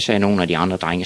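ordinary delivery

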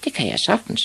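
put-on very high-pitched boy voice; high little-boy character voice; little boy Ivan. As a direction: squeak it out as a confident kid. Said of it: "sagde Ivan".

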